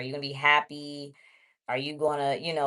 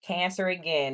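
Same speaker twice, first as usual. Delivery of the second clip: ordinary talk, nothing marked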